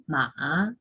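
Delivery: "ma" dipping low, then rising; third tone. Shown as a demonstration. Teacher reciting the four tones, falling-rising "ma". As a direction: scoop "ma" down and back up. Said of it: "ahí van los cuatro tonos del chino".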